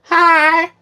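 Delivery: put-on very high, loud little old lady. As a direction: put on a high-pitched old woman greeting. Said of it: "I'm gonna do my old lady voice".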